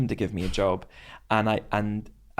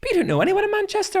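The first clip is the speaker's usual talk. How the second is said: much higher, fast fretting mum; mum's voice; 4 dB louder, faster than usual. Retold or imitated. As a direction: squeak out mum's worry. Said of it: "she went".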